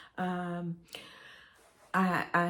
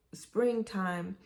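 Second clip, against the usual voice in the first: normal talking voice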